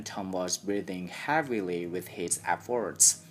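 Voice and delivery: plain reading voice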